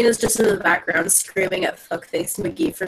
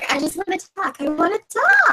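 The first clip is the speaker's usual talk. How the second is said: high wheedling call, rising higher; hammed-up demand, pitched up on the repeat; mock screaming; 8 semitones higher than usual. Player as Karina chasing her fleeing attacker, mock screaming. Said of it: "She's literally screaming out".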